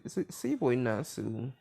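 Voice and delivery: ordinary talk, nothing marked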